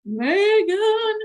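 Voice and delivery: loud, high yell of her name; father bellowing at his daughter. Acted. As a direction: shout her name across the house. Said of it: "yelled her father".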